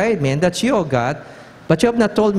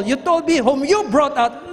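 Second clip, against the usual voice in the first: pleading shout; 10 semitones higher than usual. Acted. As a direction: cry out to the Lord, pitched high. Preacher voices Moses pleading loudly.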